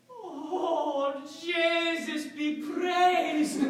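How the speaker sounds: high, drawn-out Irish exclamation; Irish rejoicing. Acted. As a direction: praise heaven slowly in an Irish accent. Agent rejoices at the move.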